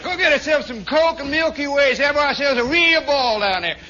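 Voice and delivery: loud, high Southern holler; excited Southern soldier rounding up his buddies; hammed-up Southern accent at a shout. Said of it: "how many Southern accents there were".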